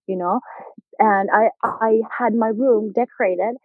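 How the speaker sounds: ordinary talk, nothing marked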